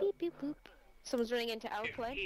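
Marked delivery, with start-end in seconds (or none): 0.0-0.5 high playful beep-boop sounds; beeping noises. Pretend. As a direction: chirp it like a robot. Mimicking radar ping noises.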